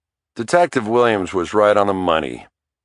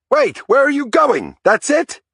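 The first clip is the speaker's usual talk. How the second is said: very high, anguished cry; stricken cry; 3 dB louder than usual. A narrator's reading. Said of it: "Santinella cried, as if he'd just been knifed".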